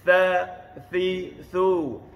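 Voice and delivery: Tha, thi, thu with tongue tip at the teeth; drawn-out th on each of Tha, thi, thu. Shown as a demonstration. Teacher drills the letter, tongue-tip th. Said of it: "hujung lidah".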